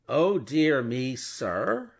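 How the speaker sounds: the lawyer mocking the banker's dismay; indignant echo. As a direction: throw the words back, affronted. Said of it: "repeated Stryver".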